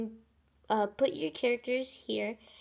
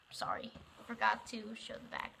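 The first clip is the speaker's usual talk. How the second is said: laughing whisper; 9 dB quieter than usual. Real, not acted. Laughing apology after a slip.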